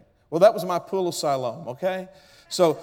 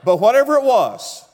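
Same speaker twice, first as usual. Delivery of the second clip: emphatic burst; 6 semitones higher, 6 dB louder than usual. Real, not acted. Preacher's sudden emphatic burst.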